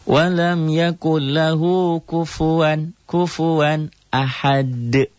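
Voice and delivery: slow, high melodic tartil chant; Quran recitation. Shown as a demonstration. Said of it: "teacher recites Quran verse". (lantunan tartil pelan)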